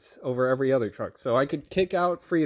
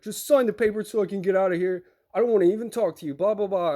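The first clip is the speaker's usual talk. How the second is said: imitated irate driver; 3 semitones higher, faster than usual. Irate driver wanting out of here, imitated irritation. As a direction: brush him off like a fed-up driver. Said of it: "he gets irate".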